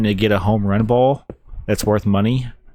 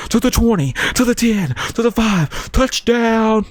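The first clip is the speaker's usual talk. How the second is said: much higher, hyped play-by-play call; football commentator; 13 semitones higher than usual. Retold or imitated. Mimicking football commentators on a long run, excited high call. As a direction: build to the touchdown like a frantic announcer. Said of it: "these commentators are like".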